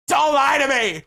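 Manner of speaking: high mock shriek of accusation; hammed-up furious scream; combative girlfriend. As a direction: explode as the angry girlfriend. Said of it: "loved fighting, just gets in fights".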